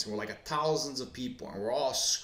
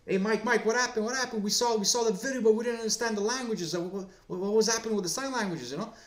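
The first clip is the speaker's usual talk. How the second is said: much higher, eager crowd calling to Mike; a pushy fan shouting questions, pitched way up; excited crowd voice; 8 semitones higher than usual. Acted. Crowd pressing Mike for answers.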